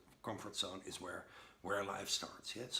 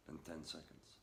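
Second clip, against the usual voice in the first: ordinary talk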